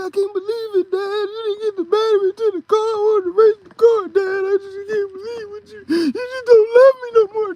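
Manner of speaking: put-on high whiny crying child voice; boo-hooing kid. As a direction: wail it like a heartbroken little kid. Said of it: "I was crying, boo-hooing".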